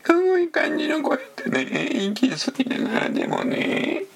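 very high squeezed voice on the in-breath; talking while inhaling. Shown as a demonstration. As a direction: breathe in and squeak the words.

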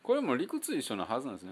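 normal talking voice

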